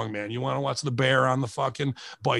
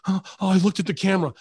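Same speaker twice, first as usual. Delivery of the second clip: higher, quick fretting; mock panic; 6 semitones higher, much faster than usual. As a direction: fret like you have been caught slipping. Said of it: "I'm not gonna be like".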